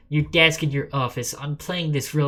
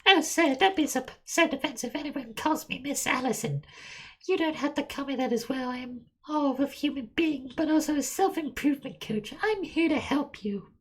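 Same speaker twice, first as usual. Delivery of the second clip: high-pitched self-improvement coach; Miss Allison; 12 semitones higher than usual. Acted. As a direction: speak far above your own pitch, in falsetto. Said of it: "I can't do that high-pitched voice".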